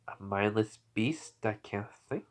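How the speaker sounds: slow, soft, offended question; quiet menace. Acted. Nine-Tails answering the Hokage's insult, slow quiet menace. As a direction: repeat the insult slowly and quietly.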